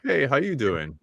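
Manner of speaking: ordinary talk, nothing marked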